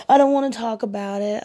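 high, loud whiny imitation; mock crying moan. Woman mimicking her date refusing to talk, mock tearful whine. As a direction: wail it like a sulky man faking tears. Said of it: "he was crying and he was moaning".

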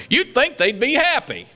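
ordinary delivery

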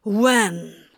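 curt angry demand; snarling. A narrator's reading. Crowley demanding details of Gabriel's threat, snarling. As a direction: snarl out the question. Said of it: "Crowley snarled".

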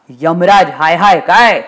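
slow, scornful repeat of a slogan; indignant echoed chant; mocking echo. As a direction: throw their chant back with menace. Yamraj echoes the children's slogan.

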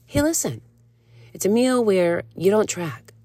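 a hint of the patient coach laying it out; calm coach. Coach calmly explaining a free meal to a panicked client. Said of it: "And she's like".

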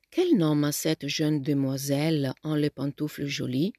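put-on friendly boy asking in French about a girl; amiable French. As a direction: ask it in French with gentle charm. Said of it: "chiese Lori amabilmente".

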